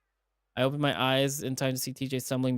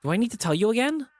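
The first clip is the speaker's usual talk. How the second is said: high, fast, louder snap; exasperated demand; 10 semitones higher, 5 dB louder, faster than usual. Acted. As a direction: fire it off impatiently. Chase exasperated.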